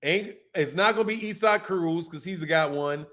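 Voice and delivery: normal talking voice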